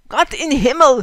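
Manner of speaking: loud startled German cry; shouted. Acted. Fritz finds Lena in his wagon, shouting. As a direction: cry out in shock. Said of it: "he shouted".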